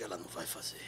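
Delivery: low disbelieving whisper; whispered aside. Retold or imitated. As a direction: whisper it to yourself, stunned.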